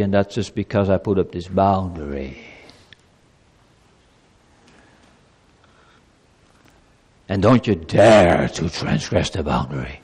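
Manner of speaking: stern ultimatum in a low voice; harsh threatening God. Shown as a demonstration. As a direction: threaten like an angry tyrant. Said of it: "That's not how God speaks".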